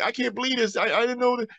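doctor amazed at the recovery; astonished doctor. Retold or imitated. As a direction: marvel at what you just saw.

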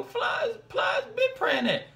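put-on high, slow Florida accent; exaggerated high Florida rapper twang; imitated Florida drawl. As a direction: pitch it way up and drag it out, mock Florida. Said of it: "So they really talk like this".

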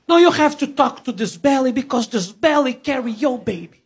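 ex-wife insisting to her husband; imitating ex-wife. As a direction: nag him to talk to the belly. Voicing pregnant ex-wife.

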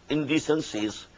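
normal talking voice

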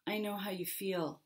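soft sympathetic whisper; a soft whisper to the waves. Acted. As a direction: whisper it to the angry sea. Jim confides to the waves.

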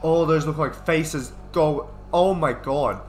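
spooked and loud; alarmed outburst. Real, not acted. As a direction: cry out in real alarm at a scare. Frightened by faces in game.

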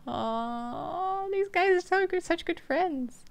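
high slow cooing; gushing aw. Real, not acted. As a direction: coo over how sweet they are. Gushing over the friendship.

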